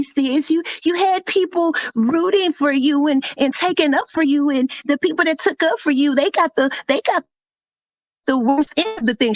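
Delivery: high-pitched mimicry of an accuser; high, put-on scolding; mocking imitation. Mocking retelling of the woman's accusation.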